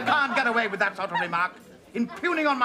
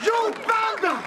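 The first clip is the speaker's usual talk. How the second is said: high indignant yell of outrage; furious shout; 12 semitones higher, slower than usual. Acted. Husband yelling at the guest he thinks insulted his wife, furious shout. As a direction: bellow the insult like a wronged gentleman.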